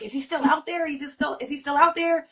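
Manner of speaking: high, urgent retold question; anxious questioning.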